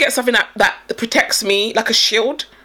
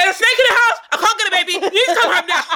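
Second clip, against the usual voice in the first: high, laughing mock panic; panicked mom on the phone; 8 semitones higher, faster than usual. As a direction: squeal it out like a frantic mother calling her husband. Friend acts out panicked phone call.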